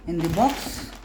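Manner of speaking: ordinary talk, nothing marked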